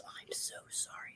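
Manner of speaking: hushed, unvoiced apology; retold whisper. The ashamed passenger apologizing to the driver, whispered. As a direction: breathe the apology with no voice.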